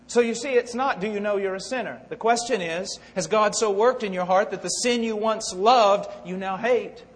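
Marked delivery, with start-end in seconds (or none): none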